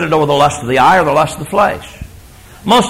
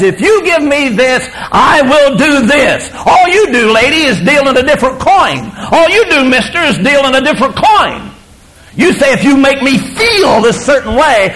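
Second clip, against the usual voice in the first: fiery preaching; 7 semitones higher than usual. Real, not acted. Preacher hammering the congregation about marriage vows, in full fiery preaching. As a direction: drive the point home from the pulpit.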